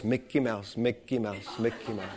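low, hushed mocking chant. Weird lady passing the preacher, quietly mocking him. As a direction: imitate a passer-by needling under the breath. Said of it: "she was mocking me".

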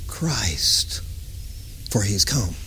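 a single hushed whispered word. Real, not acted. Preacher unveiling the answer to the congregation, whispered. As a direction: drop to a whisper on the reveal.

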